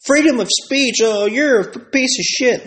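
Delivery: high whiny mocking voice; sarcastic imitation of complainers; mocking online crowd. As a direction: parody the people posting outrage.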